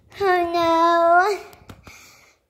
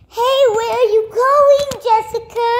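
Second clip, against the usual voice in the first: calling out; 5 semitones higher, 5 dB louder than usual. Pretend. Stopping a friend from leaving.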